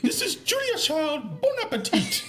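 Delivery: put-on very high, slow, warbling Julia Child voice; Julia Child impression. Friend imitating Julia Child's TV opening, high and slow. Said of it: "such a strong British accent".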